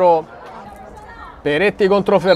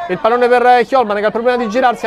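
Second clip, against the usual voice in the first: ordinary talk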